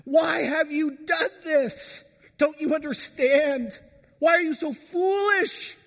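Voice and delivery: high, slow, crying plea of a frantic mother; distraught mom crying out. Acted. Mother crying out to her teenage son, high anguished wail. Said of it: "it's the mom crying out".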